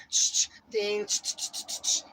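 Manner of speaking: whirring shh sounds with a high ding; imitated rotary dial. Imitating a rotary phone dialing, whirring and dinging. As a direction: mimic the dial spinning back. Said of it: "you be like".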